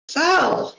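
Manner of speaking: high, drawn-out mock hiss of a name; a high-pitched scolding call, lightly hissed; hissed warning. A narrator's reading. Tabbs shushing Zell while trying not to smile, high mock hiss. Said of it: "Tabbs hissed".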